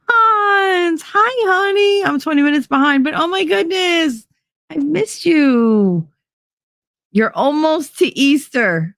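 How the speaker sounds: high, sing-song cooing hello; sweet greeting. Real, not acted. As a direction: coo a hello to a dear viewer. Greeting a viewer on stream.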